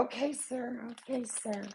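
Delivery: mouse-quiet and timid, just going along; meek Vic. Retold or imitated. Shy ex-husband meekly answering the doctor, barely audible. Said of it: "He's very shy and quiet".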